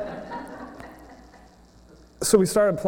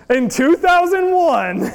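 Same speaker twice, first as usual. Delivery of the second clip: laughing; 6 semitones higher than usual. Real, not acted. Laughing while recalling.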